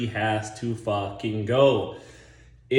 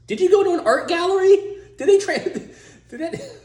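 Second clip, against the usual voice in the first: much higher and louder, dissolving into laughter; mock accusing girlfriend; 13 semitones higher, 3 dB louder than usual. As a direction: shriek the accusation, then crack up. Mocking the girlfriend's change.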